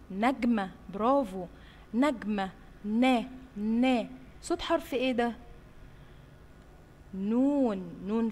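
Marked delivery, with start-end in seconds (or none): 2.8-4.1 نا stretched very slowly; slow syllable demo. Shown as a demonstration. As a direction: drag out نا for kids. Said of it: "teacher sounding out syllable". (نطق نا ببطء شديد)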